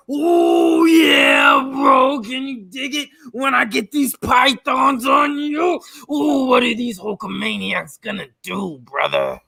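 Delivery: hammed-up wrestler promo. Wrestling promo impression for the stream.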